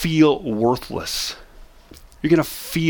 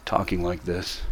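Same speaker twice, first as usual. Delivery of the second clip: low, much softer, droopy depressed tone; 8 semitones lower, 9 dB quieter than usual. Shown as a demonstration. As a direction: sound drained and defeated. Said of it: "Your tone of voice is".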